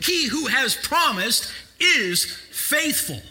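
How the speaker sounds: high, loud, shouted preaching; preaching at full force. Acted. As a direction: drive it home to the congregation. Preaching climax.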